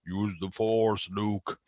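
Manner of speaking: slow, lightly attempted resonant voice; a modest try at a booming movie voice; James Earl Jones voice. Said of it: "James Earl Jones".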